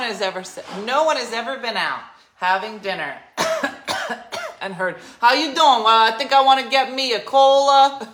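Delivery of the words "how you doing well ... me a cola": put-on loud, high Jersey accent; brash, hammed-up Jersey diner. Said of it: "Yeah, Jersey".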